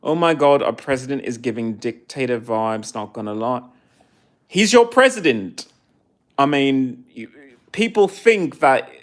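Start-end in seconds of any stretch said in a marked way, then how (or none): 4.5-5.7 pointed retort. Real, not acted. Retorting to American viewer.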